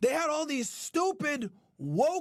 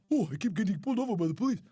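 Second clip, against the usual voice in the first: mock whining; 9 semitones lower, faster than usual. Mocking complainers.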